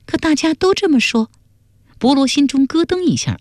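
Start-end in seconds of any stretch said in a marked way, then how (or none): 0.0-1.3 put-on much higher-pitched young woman's voice; daughter's protest. Daughter doubting her father's denial, high-pitched protest.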